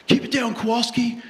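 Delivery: high laughing mock coach; coach scolding. Coach hushing a doubting player, laughing. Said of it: "He's like".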